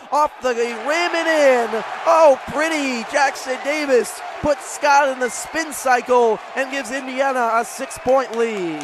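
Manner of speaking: thrilled play-by-play yell; excited call. Real, not acted. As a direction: celebrate the spin move on air. Jackson Davis spin move scores.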